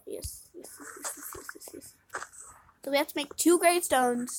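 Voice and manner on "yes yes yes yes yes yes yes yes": soft unvoiced string of yeses; whispered yeses. Real, not acted. Player whispering yes to herself in relief. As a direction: whisper it to yourself quickly.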